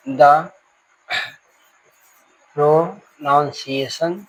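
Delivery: word by word with long pauses; slow dictation. Real, not acted. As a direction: stretch each word as you write it out. Dictates a definition while writing. (धीमे, एक-एक शब्द बोलकर लिखवाना)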